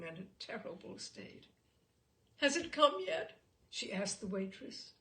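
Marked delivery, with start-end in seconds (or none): 2.4-3.4 high, loud anxious ask; a mother dreading a delivery, anxious; anxious question. Acted. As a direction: blurt it out in a worried rush. Anxious mother asks servant.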